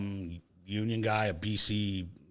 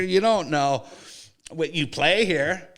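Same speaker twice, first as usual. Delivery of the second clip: ordinary talk, nothing marked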